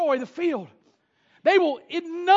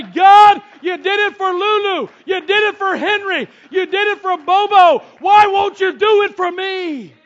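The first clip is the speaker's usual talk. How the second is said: shouted plea to God; desperate prayer; 5 semitones higher, 10 dB louder than usual. Pretend. Believer crying out to God at the altar, shouted plea. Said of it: "grab the horns of the altar and say".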